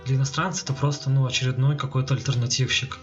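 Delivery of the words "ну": usual speaking voice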